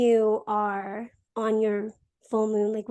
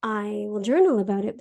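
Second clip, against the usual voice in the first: normal talking voice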